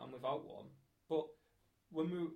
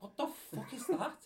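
slow, much higher, laughing annoyed outburst; mock groggy annoyance; 11 semitones higher, 4 dB louder, slower than usual. Woken by the noise, slow mock annoyed cry, higher. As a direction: wake up cross but laughing about it. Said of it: "I'm like".

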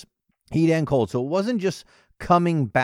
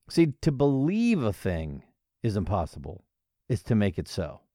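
ordinary talk, nothing marked

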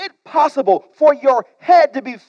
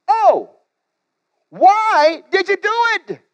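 high, drawn-out groaning plea; groaning sailors; 10 semitones higher, slower than usual. Acted. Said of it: "They groaned".

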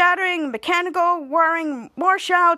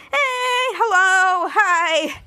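excited greeting; 5 semitones higher, 3 dB louder, much slower than usual. Retold or imitated. Shout Out arrives greeting.

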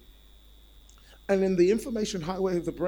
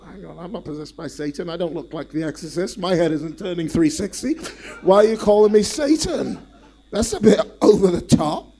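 mock indignant Peter; 2 semitones higher, 11 dB louder than usual. Peter's indignant imagined reply.

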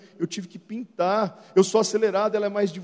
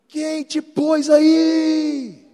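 high, slow, loud outraged cry; indignant yell; 8 semitones higher, 6 dB louder, much slower than usual. Retold or imitated. Offended pastor yelling at the usher, mock outrage. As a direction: shout down the usher.

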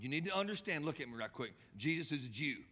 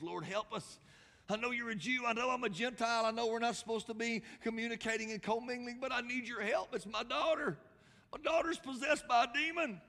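high-pitched mother begging, building louder; desperate pleading; 10 semitones higher, 5 dB louder than usual. Retold or imitated. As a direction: act out a frantic plea, climbing higher. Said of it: "she came to him pleading".

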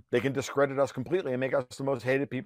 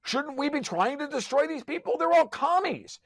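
worked-up rant, voice climbing high; fired-up sarcastic tirade, pitched high; heated rant; 10 semitones higher than usual. Real, not acted. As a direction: rant with rising outrage.

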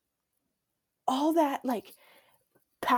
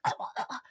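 choking on a throat swab; mock gag noises, no voice; imitated gagging; 4 dB quieter, faster than usual. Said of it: "you're gagging and choking".

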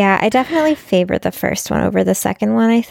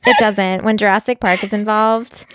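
ordinary delivery

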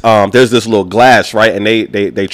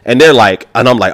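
usual speaking voice

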